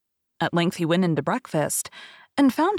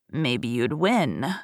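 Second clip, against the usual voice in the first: put-on slow, lower crafty old beggar; sly beggar; 6 semitones lower, much slower than usual. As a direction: drawl it out slyly. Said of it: "said the bocough".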